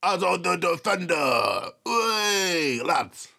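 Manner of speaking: mock Cockney orc grumbling to his mates; hammed-up Cockney lad; Cockney geezer. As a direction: put on a rough-and-ready Cockney accent. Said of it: "I wanted to hear fucking Cockney geezers".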